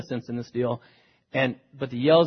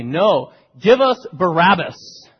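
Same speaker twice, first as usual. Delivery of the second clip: louder, higher, slow demand from the crowd; 4 semitones higher, 9 dB louder, slower than usual. Retold or imitated. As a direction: call out for Barabbas like a mob.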